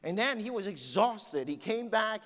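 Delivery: high, loud sermon storytelling; impassioned preacher telling a story; fired-up preaching. Acted. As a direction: preach the rescue with rising urgency. Preaches the rescue story fervently.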